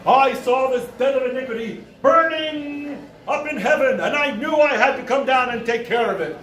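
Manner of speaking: declaiming prophet, stretching key words; retold grandiose testimony from heaven; self-proclaimed archangel. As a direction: preach it with deranged conviction. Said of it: "He's like".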